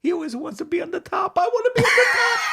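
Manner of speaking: high Italian wife building to a shrill shout; hammed-up old Italian lady, escalating; old Italian woman. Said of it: "she brings the old Italian woman in".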